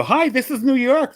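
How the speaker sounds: high, loud, drawn-out phone greeting; prank caller character. Retold or imitated. Prank caller greeting a stranger on the phone, high and loud.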